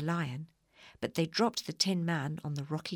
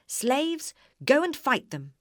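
much higher and louder witch command; shouting order; 8 semitones higher, 7 dB louder, slower than usual. A narrator's reading. Witch yelling at her slaves to fight. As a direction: yell the order to attack.

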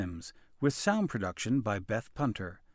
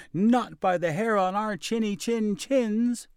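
little pigs voice; 9 semitones higher, 3 dB louder than usual. A narrator's reading. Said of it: "the little pigs replied".

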